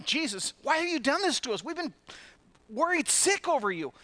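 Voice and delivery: high, loud scolding mother's voice; mock exasperated parent scolding a lost child. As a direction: scold him like a frantic mum. Preacher acts out Mary's rebuke.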